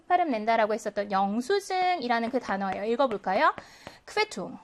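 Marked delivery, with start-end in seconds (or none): 4.1-4.6 Quittung with the stress on QUIT; first-syllable stress. Shown as a demonstration. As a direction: model Quittung, high on the first syllable and dropping away softly. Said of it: "첫 번째 모음의 강세가 오는".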